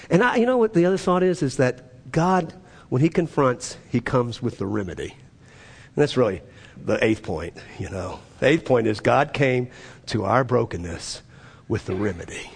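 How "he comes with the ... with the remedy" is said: quiet tender emphasis on the remedy; hushed and sincere. Acted. Pastor gently telling the congregation God brings the remedy, soft and low. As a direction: drop low and soft, let it land. Preacher drops to low intimacy.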